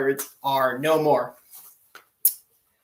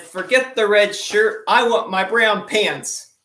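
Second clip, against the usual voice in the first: louder, higher panicked order; captain panicking; 6 semitones higher, 6 dB louder, slower than usual. Retold or imitated. Captain tells the chief mate he wants brown pants, loud. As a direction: blurt out the punchline in a panic. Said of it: "that captain said".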